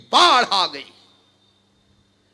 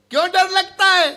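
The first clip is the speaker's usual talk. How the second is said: high-pitched, indignant demand; sharp challenge; 7 semitones higher, 4 dB louder, faster than usual. Acted. Preacher challenging the fearful devotee, sharp and indignant. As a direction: throw the question back, pitched up.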